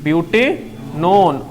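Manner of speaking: Butanone stretched out slowly, syllable by syllable; slow model pronunciation. Shown as a demonstration. Teacher to students, modelling the name, very slow. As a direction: say butanone very slowly, each part clear. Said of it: "तो butanone पढ़ेंगे".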